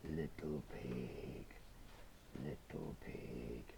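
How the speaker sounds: a sly wolf crooning low at the door. Acted. Big bad wolf calls the little pig, very low and slow. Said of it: "He says".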